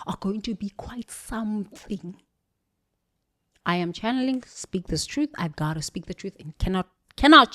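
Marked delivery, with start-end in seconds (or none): 0.1-2.2 whisper. Real, not acted. Grim prediction to viewers.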